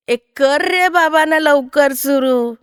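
slow, drawn-out call to get started; impatient child. Acted. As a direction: urge the others on like an impatient kid. Child urging start of game.

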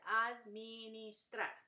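"administrar" slowly in four beats; "administrar" spelled out by syllable for learners; split into syllables. Shown as a demonstration. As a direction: break "administrar" as ad, mi, nis, trar. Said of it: "que se dividen".

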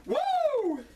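high, shrieking wrestler's woo; a strutting champion's woo; Ric Flair woo. Acted. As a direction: let out the signature Flair woo. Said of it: "Ric Flair would break into my house".

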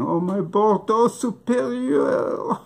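high, slow mock cry of loss; mock wail. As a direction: wail for the lost wine. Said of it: "you'll be going".

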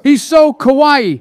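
high, slow gushing. Acted. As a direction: swoon over him slowly. Gushing over Biden.

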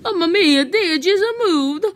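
high-pitched mock-Italian lament. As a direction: exclaim in a hammy Italian accent. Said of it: "I kinda like the Italian voice".

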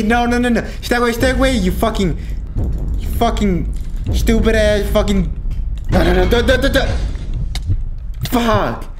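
high, frantic begging and cursing; frightened gamer fending off an attacker; panicked pleading. Real, not acted. Chased by enemy in horror game.

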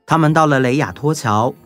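ordinary delivery

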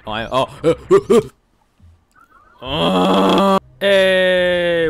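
panicked yelling gasps; gasping, terrified, loud; hyperventilating. Real, not acted. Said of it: "there was a lot of yelling".

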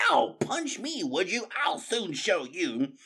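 high pained outrage; hurt angry cry. Acted. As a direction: yelp then vow revenge. Rat hit in fight.

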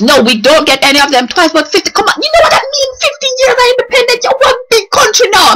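building to a high-pitched exclamation; excited rant. Real, not acted. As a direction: work yourself up, pitch soaring. Mounting hype about the anniversary.

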